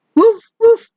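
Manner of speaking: two high, loud woofs; dog barking. Shown as a demonstration. Teacher showing what a dog says, barking. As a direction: bark like a small dog, twice. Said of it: "A dog says".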